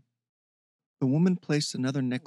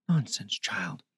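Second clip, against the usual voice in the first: gentle; 3 semitones lower, slower than usual. A narrator's reading. The woman soothes the apologising Heather, gently whispered. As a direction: comfort her tenderly. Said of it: "the woman said gently".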